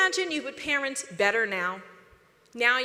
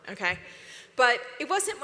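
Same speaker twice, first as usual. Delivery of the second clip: usual speaking voice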